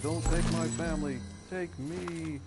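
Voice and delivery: pleading. Retold or imitated. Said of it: "he was like".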